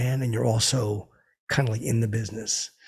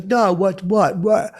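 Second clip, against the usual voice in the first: higher, louder what what what; mock indignant protest; 8 semitones higher, 7 dB louder, much slower than usual. [name] confronted by the drunk comic, loud startled protest. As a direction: fire back startled and offended.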